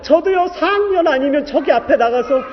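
telling God he has no tuition either, laughing and crying; laughing-crying plea. Retold or imitated. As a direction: plead with God through a laugh and a sob. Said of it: "이런 심정이 드는데".

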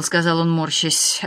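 ordinary delivery, nothing marked

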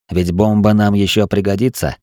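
ordinary delivery

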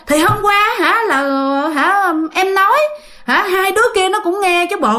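high-pitched, acted exasperation; angry insistence. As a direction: argue back, calling on witnesses. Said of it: "ông Út cũng tức dữ lắm". (giọng tức tối, cãi lại, lên cao)

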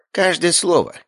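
high-pitched creature voice; rat familiar. A narrator's reading. Rat confirming it overheard everything, high voice. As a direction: answer eagerly in a high rat voice.

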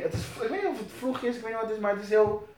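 usual speaking voice

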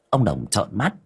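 ordinary delivery, nothing marked

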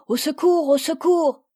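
loud, high cry for help; a girl screaming for help. Acted. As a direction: shout it across the field to the house. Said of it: "vers la maison en criant".

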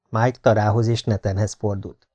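ordinary talk

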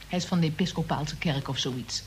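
ordinary talk, nothing marked

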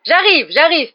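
high, loud singsong call back. A narrator's reading. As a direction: call ahead to someone waiting for you. Calling back to his girlfriend.

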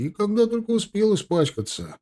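high, whining lament; vexed young soldier. A narrator's reading. Soldier grumbling over his dirty boot, high-pitched lament.

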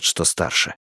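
ordinary delivery

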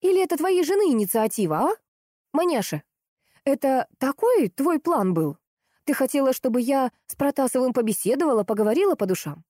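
high-pitched combative retort; worked up again. A narrator's reading. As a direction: snap back, getting worked up. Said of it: "Снова завелась Лиза". (снова заводясь)

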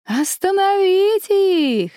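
high, slow monster shriek; shrieking vine women. Acted. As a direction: screech like swarming creatures. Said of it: "закричали женщины-лозы". (пронзительный вопль нечисти)

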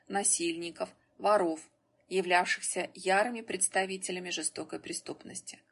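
ordinary delivery, nothing marked

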